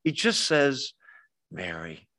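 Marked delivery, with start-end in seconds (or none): 1.5-2.1 barely raised, low loving name; hushed gentle call; quiet and tender. Retold or imitated. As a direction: say the name softly, pitched low. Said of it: "I think he says it quietly".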